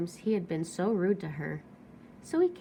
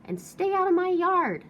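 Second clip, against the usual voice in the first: louder, higher angry yell; 9 semitones higher, 7 dB louder than usual. Acted. Cactus yelling the jackrabbit out of his yard. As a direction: shout him off the yard. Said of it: "Hank yelled back".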